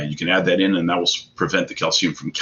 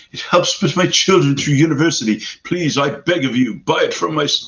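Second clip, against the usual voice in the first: mock begging; 8 semitones higher than usual. Host to viewers, mock pleading, higher pitched. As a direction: beg the viewers jokingly to buy from his site.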